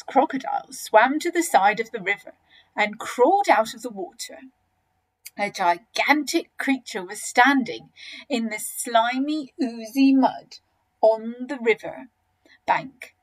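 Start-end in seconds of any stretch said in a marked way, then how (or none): none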